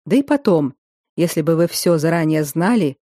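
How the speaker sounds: usual speaking voice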